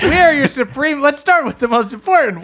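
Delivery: announcer voice. Pretend. Podcast intro, hosts announced.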